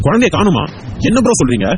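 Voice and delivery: high, shocked questions; startled disbelief. Acted. Friend hears bro's child is missing, high startled questioning. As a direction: jump up in pitch, stunned by the news.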